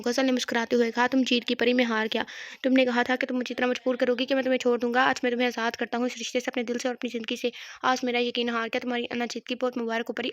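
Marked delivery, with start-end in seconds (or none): none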